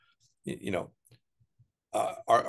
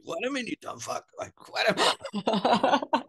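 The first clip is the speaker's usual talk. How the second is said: higher, slow laughing put-on bark; mock boss scolding; 12 semitones higher than usual. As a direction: snap at the lackey, half laughing. Boss yells at underling.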